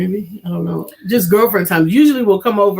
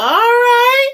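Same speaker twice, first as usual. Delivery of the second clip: high drawn-out cheer; 5 dB louder than usual. Real, not acted.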